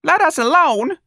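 very high, loud shrill plea; cornered lady cries out shrilly. Acted. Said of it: "a shrill voice said".

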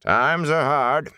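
high, slow, resigned innkeeper; resigned innkeeper's complaint. A narrator's reading. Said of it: "he said in a resigned voice".

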